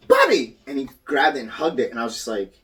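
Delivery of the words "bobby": high, loud delighted cry, imitated; imitating a kid spotting his uncle; child's excited recognition. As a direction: call it out like a thrilled toddler. Quotes child greeting uncle's picture.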